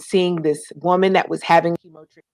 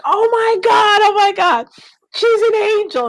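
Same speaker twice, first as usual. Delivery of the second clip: much higher, louder, gushing with amazement; overjoyed gushing; 4 dB louder than usual. Retold or imitated. Grateful couple overheard calling her an angel, high excited gushing. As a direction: gush like a grateful stranger who can't believe it.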